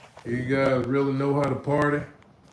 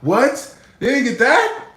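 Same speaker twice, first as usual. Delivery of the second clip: rising, higher disbelieving question; mock shock, voice climbing higher; surprised disbelief; 7 semitones higher, 4 dB louder than usual. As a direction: act stunned the joke flopped. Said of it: "I'm like".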